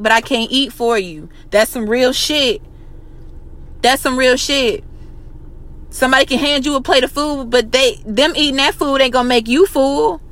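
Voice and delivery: ordinary talk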